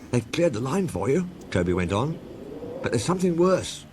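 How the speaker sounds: a hint of nervous worry; worried Toby voice. A narrator's reading.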